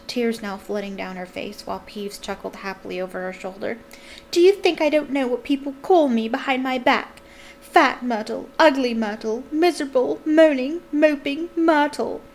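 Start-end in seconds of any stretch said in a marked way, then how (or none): 4.3-12.2 wailing, louder and higher; self-pitying teary rant; tearful outburst. A narrator's reading. Myrtle sobbing and ranting.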